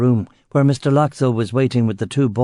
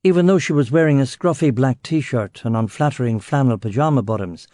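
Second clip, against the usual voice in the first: plain reading voice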